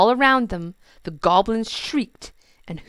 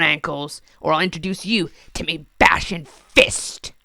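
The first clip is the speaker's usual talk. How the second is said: bossy goblin king; 2 semitones higher, 8 dB louder than usual. Acted. King giving orders loudly.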